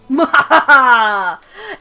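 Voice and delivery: loud, long mock-evil laugh; gloating villain laugh. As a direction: laugh like a triumphant cartoon villain. Gloats after scaring the fish.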